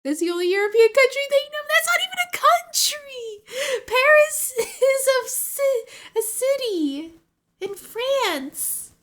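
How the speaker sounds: laughing indignation. Real, not acted. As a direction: protest through laughter. Indignant laughing rant about Paris.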